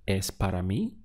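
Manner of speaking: "Es para mí?" with a sharp rise on "mí"; question intonation. Shown as a demonstration. Teacher shows how to ask it, "Es para mí?" rising on "mí". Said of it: "use the intonation of your voice".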